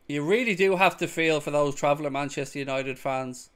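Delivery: ordinary talk, nothing marked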